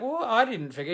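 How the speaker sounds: put-on high-pitched objection; mock indignation. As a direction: squeak out an indignant retort. Acts out protest to boss.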